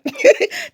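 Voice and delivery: a high burst of laughter. Real, not acted. Amused at her own Creole insult, laughing high.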